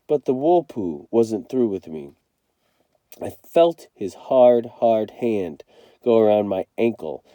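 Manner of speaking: usual speaking voice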